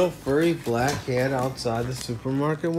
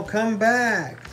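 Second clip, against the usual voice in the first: calling out; 7 semitones higher than usual. A narrator's reading. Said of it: "cried Elmo".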